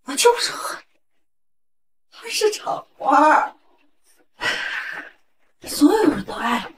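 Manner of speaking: bitter and broken. Acted. Bitter confession of hatred.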